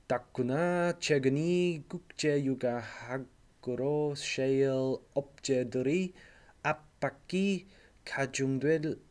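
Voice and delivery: fast, rattled-off Korean with an English speaker's accent; terrible Korean reading accent. Shown as a demonstration. English teacher reading Korean news to class, fast learner's accent. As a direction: race through the Korean like a learner reading aloud. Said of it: "my terrible Korean reading accent".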